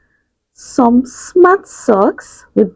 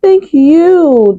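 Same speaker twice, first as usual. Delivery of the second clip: higher, louder squeaky thanks; squeaky mice; 6 semitones higher, 4 dB louder than usual. Acted. Baby mice thanking George, high and squeaky. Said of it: "they squeaked".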